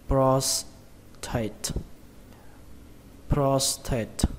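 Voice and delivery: "prostate" stressed on PROS, falling on "tate"; stress on first syllable. Shown as a demonstration. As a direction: model "prostate" slowly with the first syllable strong. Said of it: "trọng âm của nó rơi vào âm đầu tiên". (nhấn âm đầu "pros" trong "prostate", xuống giọng ở "tate")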